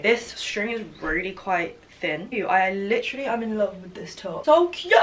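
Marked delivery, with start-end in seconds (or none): 4.4-5.0 excited squeal. Real, not acted. Loving the top she tries on.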